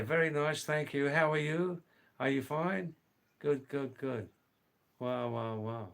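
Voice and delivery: usual speaking voice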